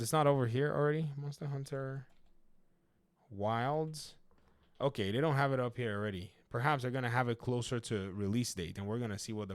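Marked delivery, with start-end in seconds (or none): 1.2-4.1 softer, murmured while typing a search; quiet, searching. Real, not acted. Checking the CD Keys site for the game, softer, murmured. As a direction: read it half to yourself as you search.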